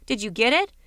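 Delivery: usual speaking voice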